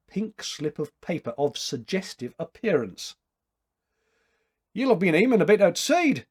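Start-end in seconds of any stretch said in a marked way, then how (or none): 4.7-6.3 higher, louder Scots brogue; lively Scottish teasing remark; Scottish tailor voice. Acted. Scottish tailor teasing his friend, in a Scots brogue. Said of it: "commented Maclachlan".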